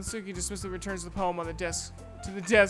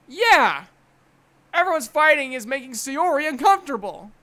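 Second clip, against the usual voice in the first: forceful, raised, hasty excuse; loud blurting; 3 semitones higher, 8 dB louder than usual. Acted. Narrator deflecting the quarrel onto Sayori, loud blurting. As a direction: seize the excuse loudly.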